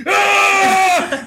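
high, loud shouted ah; dad yelling as he floors the car; angry yell. Retold or imitated. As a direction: roar in frustration. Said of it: "He's like".

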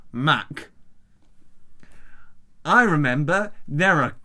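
slow, exaggerated Australian accent; bad Australian accent. Reading a garbled translated email aloud, bad Australian accent. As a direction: draw the words out in bad Australian. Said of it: "I'm just gonna do Australian".

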